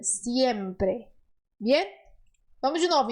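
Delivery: ordinary talk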